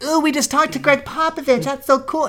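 high-pitched mock fawning; gushing TV interviewer. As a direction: gush in a put-on high voice. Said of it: "They can be like".

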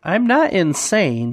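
NOT stretched high and slow; stress on NOT. Acted. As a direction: lean on the word not, slowly. Jack protesting.

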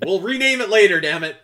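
high, loud laughing words. Real, not acted. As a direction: laugh through the line. Laughing at own silly name.

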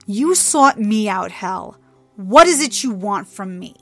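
loud, impatient demand; fed-up challenge. A narrator's reading. Trixie, over the intimidation tactics, confronts Hel impatiently. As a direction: push back against the intimidation.

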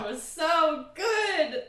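slow, high, loud gushing; excited. Real, not acted. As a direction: gush with delight, stretching it out. Gushing over the episode.

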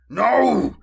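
loud, high growled cry; growled shout. A narrator's reading. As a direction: bark it out. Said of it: "Uzaz growled".

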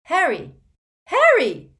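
high, loud calling through the door; frantic shout. Acted. Hermione shouting through the bathroom door, frantic. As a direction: yell his name in alarm.

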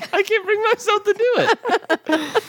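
high, slow words through laughter; laughing. Real, not acted. I can't stop smiling.

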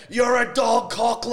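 high, loud crowd heckle; top-of-the-lungs sports heckle; heckling yell. Retold or imitated. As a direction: bellow it from the stands. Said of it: "I yelled out".

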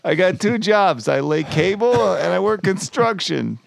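high, loud put-on caller; mock caller voice. Podcast host playing the caller, high and loud. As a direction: join the bit as the menacing caller.

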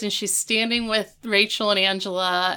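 usual speaking voice

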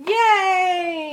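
loud happy cheer. Real, not acted. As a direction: cheer like a crowd. Cheering the announced idea.

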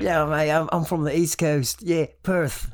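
loud, high mock Aussie voice; mimicking a friend's fake Australian accent. Teasing a friend about his fake accent, in a loud mock Australian accent. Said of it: "That what you said was".

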